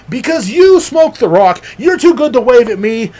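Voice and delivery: high, loud outrage; indignant rant. Acted. Rant at couple who snubbed him.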